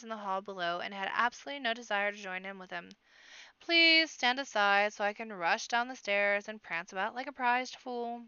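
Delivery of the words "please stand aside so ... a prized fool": loud, mock-pleading sarcasm; sarcastic. A narrator's reading. Boy refusing to join his sister at the festivities, sarcastic.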